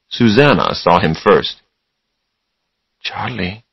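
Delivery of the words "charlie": hushed, much softer call; quiet voice. A narrator's reading. Susanna alerting Charlie in bed, quiet voice. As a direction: say the name very softly. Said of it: "she said in a quiet voice".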